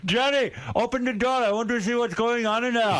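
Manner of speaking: bang on the door in a fake Indian accent; mock Indian accent. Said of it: "he does not speak like that".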